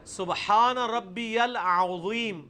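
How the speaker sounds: slow recitation, العظیم with throaty ع and heavy ظ; deliberate tajweed, full ain in العظیم; correct Arabic articulation. Shown as a demonstration. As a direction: draw out سبحان ربی العظیم as a model. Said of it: "تسی پڑھ دیو عظیم".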